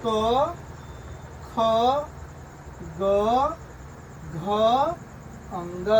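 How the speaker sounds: slow, spaced letter-by-letter recitation; teacher reading the alphabet aloud for pupils; letter drill. Shown as a demonstration. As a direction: call out each letter with a pause. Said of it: "कैसे पढ़ें?".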